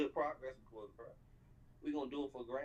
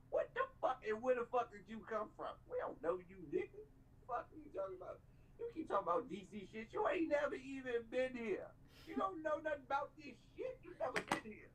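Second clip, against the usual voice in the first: high-pitched angry rant; 10 semitones higher than usual. Real, not acted. Heated rant at a commenter.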